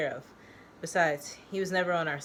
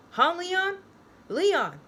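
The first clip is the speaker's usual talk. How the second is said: shrill, much higher and louder cry of a name; hysterical yelling; 12 semitones higher, 6 dB louder, much slower than usual. Acted. Joe yelling for dead Leon in hysteria, much higher shout. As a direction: yell the name like he's lost it. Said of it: "He laughs hysterically".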